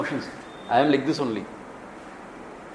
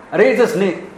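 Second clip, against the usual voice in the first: higher and louder, pushing back hard; insistent excitement; 4 semitones higher, 4 dB louder than usual. Acted. Walker insists to his friend it's a snake, agitated. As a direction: argue that it is surely a snake. Two people quarrel over snake.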